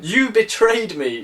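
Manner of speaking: hurt, furious yell; yelled accusation. Acted. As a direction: fling the betrayal at him. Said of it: "she yelled".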